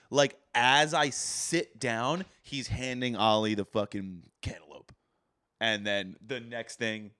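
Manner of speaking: normal talking voice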